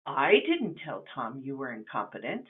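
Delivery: "I" stressed, questioning rise at the end. Shown as a demonstration. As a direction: lean on "I", implying someone else did it. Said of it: "It wasn't me that did it".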